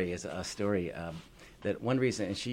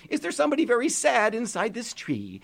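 calling into tree; 14 semitones higher, 5 dB louder than usual. Acted. George calls into tree.

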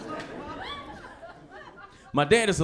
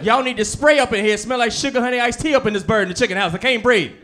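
loud, higher mom voice; fussy mom demanding air freshener; mom complaining; 6 semitones higher, 4 dB louder than usual. Retold or imitated. Mom scolding the family about a smell, loud. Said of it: "she'd be like".

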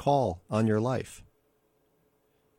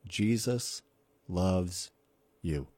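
each word set apart, slow and deliberate; slowly, one word at a time; real slow; 3 semitones lower, 4 dB quieter, much slower than usual. Shown as a demonstration. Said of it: "Let me say it again real slow".

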